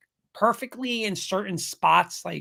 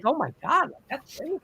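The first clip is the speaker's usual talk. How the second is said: high incredulous voice, trailing softer; retold amazement; 7 semitones higher, 4 dB quieter than usual. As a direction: replay the astonishment, high and fading. Retelling amazed reaction to likeness.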